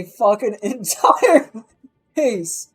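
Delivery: slow, loud words through laughter; laughing through it. Real, not acted. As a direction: push the words out while cracking up. Laughing while telling.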